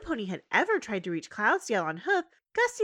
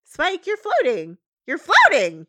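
higher, louder panic; alarmed Twilight; 10 semitones higher, 10 dB louder than usual. Retold or imitated. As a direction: yell it in cartoon alarm.

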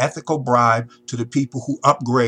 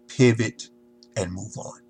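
ordinary talk

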